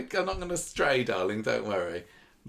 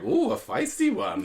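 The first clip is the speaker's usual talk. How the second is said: much higher, slow, laughing leer; leering admirer; 14 semitones higher, 5 dB louder than usual. Retold or imitated. Idris eyes up Leanne after her threat, smarmy amused leer. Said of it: "Idris is there going".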